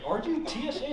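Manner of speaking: high, loud acted question; mock eager question.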